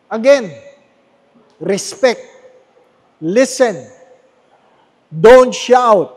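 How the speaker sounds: loud preacher's warning; emphatic command. Real, not acted. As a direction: bark the rule out.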